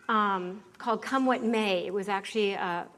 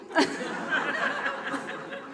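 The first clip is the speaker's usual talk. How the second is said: real high laughter bursting out; 6 semitones higher than usual. Real, not acted. Laughing at her own movie story, real laugh. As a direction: let a high laugh break out.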